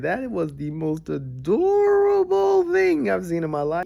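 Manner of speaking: slow, drawn-out adoring gush. Real, not acted. Melting at puppy cheerleaders, slow and adoring. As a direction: stretch "adorable" out like cooing at puppies.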